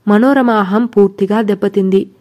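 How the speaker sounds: ordinary delivery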